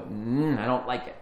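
low, dismissive mm then the words; knee-jerk dislike, mildly mocked; dismissive grunt. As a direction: brush it off with a grunt. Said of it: "we just go".

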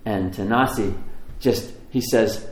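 ordinary talk, nothing marked

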